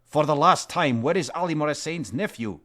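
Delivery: put-on final warning to the drunk; yelling for the last time; shouted demand.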